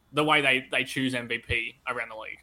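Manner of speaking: normal talking voice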